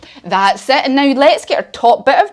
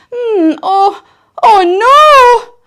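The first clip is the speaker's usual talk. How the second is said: mock dismay; 11 semitones higher, 7 dB louder, much slower than usual. Bear finds empty cupboard.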